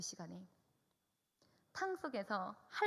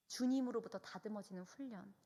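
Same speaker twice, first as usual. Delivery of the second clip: usual speaking voice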